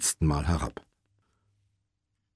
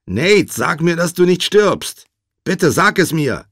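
loud, high desperate plea; raised voice, frantic with worry; anxious pleading; 11 semitones higher, 8 dB louder than usual. A narrator's reading. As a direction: beg him urgently down the phone. Josh pleads, fearing Nate dying.

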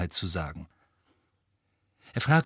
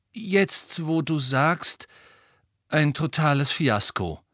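rueful admission; 6 semitones higher, 3 dB louder, slower than usual. Acted. Harry gesteht das Desaster.

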